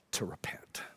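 very low, breath-only whisper. Shown as a demonstration. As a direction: whisper it as a solemn answer. Climactic word whispered, then repeated.

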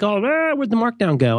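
high-pitched mock outcry; mock complaining users. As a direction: imitate a fretting crowd of users. Mimics users' outcry over features.

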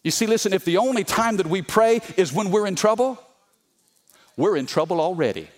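normal talking voice

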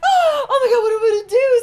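high, loud, half-laughing panic; panicked. Retold or imitated. Caught in a wardrobe mishap by the cabana: high laughing panic. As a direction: squeal it like everything is falling apart.

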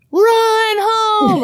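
high yelled cheer from the stands. Retold or imitated. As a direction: call it out high, parent in the bleachers. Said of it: "by saying".

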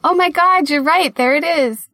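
sudden high delighted realization; surprised. Real, not acted. Co-host realizing the mic is already plugged in, surprised. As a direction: caught out, bursting with surprise.

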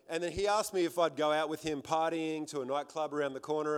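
ordinary talk, nothing marked

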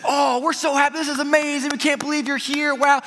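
high, excited gush; sustained high-pitched delight; retold gushing welcome. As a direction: imitate a thrilled hostess greeting guests.

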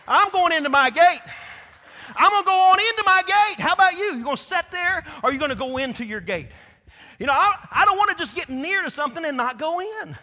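loud, high, ringing preacher's declaration; full-force preaching. Acted. Preacher declares to the congregation he's going into his gate, loud and ringing.

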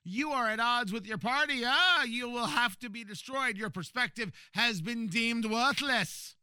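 high, loud villain decree; hammy supervillain pronouncing judgment; evil genius voice. Retold or imitated. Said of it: "that's my evil genius voice".

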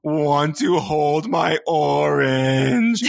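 put-on slow, drawn-out, whimpering little-boy voice; mock toddler sob-talk; sobbing toddler. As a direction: whine like a crying toddler. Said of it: "he would just start sobbing".

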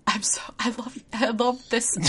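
whispered, overcome with joy. Real, not acted. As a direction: breathe it out, touched.

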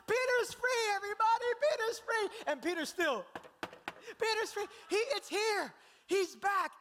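high, loud joyful announcement; excited shout. Acted. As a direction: burst in yelling the good news. Rhoda runs to announce Peter.

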